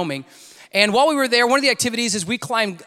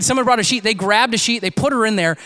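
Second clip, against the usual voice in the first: ordinary talk, nothing marked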